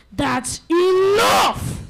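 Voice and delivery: loud, slow, stern bark; barked command. Acted. Father wolf scolding his son, loud stern bark. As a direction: cut him off sternly. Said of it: "barked Father Wolf".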